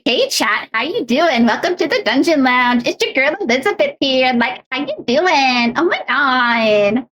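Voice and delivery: put-on high, lilting Valley Girl stream intro; Valley Girl accent. Shown as a demonstration. Greeting chat as a vapid Valley Girl, high and drawn out. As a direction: drawl out the last lines in a high Valley Girl lilt. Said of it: "I can stay in that Valley accent".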